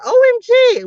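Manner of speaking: high, loud OMG shriek; excited squeal. Real, not acted. Reacting to a viewer's survival, loud and high. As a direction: burst out in delight.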